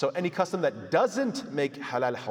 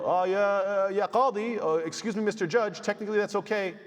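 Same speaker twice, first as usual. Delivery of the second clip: higher, hammed-up innocent excuse to the judge; mock smug litigant; 6 semitones higher than usual. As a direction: play dumb before the judge, pitched up. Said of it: "if they went to court and said".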